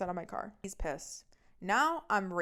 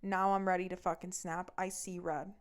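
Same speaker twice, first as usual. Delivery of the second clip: usual speaking voice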